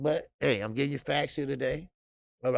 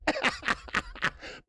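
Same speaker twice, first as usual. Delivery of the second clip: silent high wheeze of laughter; breathy wheeze laugh; 12 semitones higher, 5 dB quieter than usual. Real, not acted. Laughing at shoe joke.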